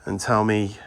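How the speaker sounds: ordinary talk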